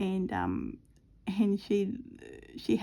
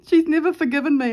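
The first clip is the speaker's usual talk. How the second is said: loud, high, quick retold protest; exclaimed complaint; 8 semitones higher, 10 dB louder, faster than usual. Mum complains cat holds a grudge, loud protest. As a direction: burst out with the grievance.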